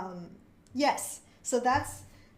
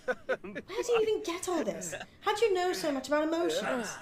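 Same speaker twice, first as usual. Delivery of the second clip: lightly British and a bit higher, puzzled; Quinn's puzzled British question; British accent; 6 semitones higher than usual. Acted. Quinn asks Rianne where she learned this, puzzled British. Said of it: "Quinn has a British accent".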